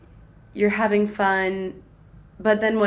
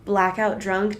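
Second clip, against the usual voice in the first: ordinary talk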